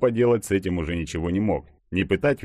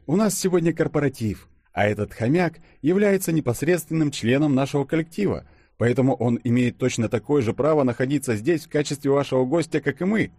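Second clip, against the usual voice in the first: higher-pitched character voice; official explanation; 8 semitones higher than usual. A narrator's reading. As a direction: take charge and explain to the waitress. Denis formally argues with waitress. (веское объяснение, голосом повыше)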